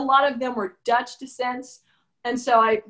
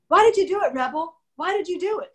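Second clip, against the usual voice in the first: demanding wife; 7 semitones higher than usual. Acted. Author acting the wife demanding why her husband cheated, higher and pressing. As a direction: confront him, pressing for an answer. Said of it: "I walked into the bathroom and I said".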